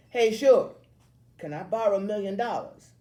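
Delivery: loud, high teasing yell. A narrator's reading. Tall kid teasing Billy before his friends, yelling. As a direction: call out mockingly across the hallway. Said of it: "One tall guy I don't know yells".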